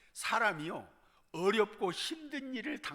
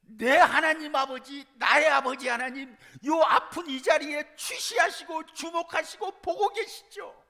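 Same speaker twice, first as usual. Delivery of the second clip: crying out in prayer; 8 semitones higher, 8 dB louder than usual. Pretend. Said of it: "하나님 앞에 부르짖는 거요". (하나님께 부르짖는 간절한 외침 기도)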